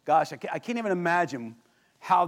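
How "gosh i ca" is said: ordinary talk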